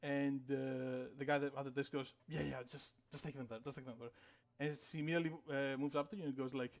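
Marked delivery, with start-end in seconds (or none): 2.3-4.1 low-key, much softer brush-off; hushed instruction. Acted. Desk guy sending colleague off with guests, hushed and hurried. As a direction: usher them along quietly, repeating yourself.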